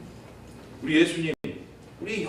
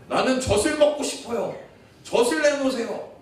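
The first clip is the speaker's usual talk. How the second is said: mock childish demand; 8 semitones higher, 3 dB louder than usual. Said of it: "이러면 변태죠".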